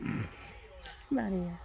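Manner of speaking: slow, laughing drawl. Real, not acted. Laughing sign-off to her viewers. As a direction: laugh it out lazily.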